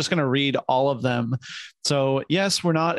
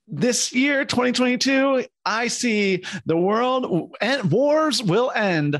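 mock prophecy; 7 semitones higher than usual.

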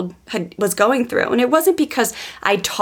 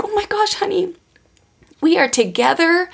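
tender comforting mom; 10 semitones higher than usual. Retold or imitated. Mother retelling how she comforted her sobbing daughter, high and tender. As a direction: coo it gently to a crying child.

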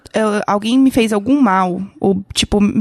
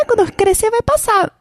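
put-on high, naive little-girl voice; imitating her younger self; lightly childish, higher; childish hopeful self; 9 semitones higher than usual. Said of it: "Aí eu falei".

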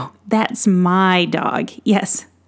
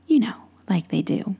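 ordinary delivery, nothing marked

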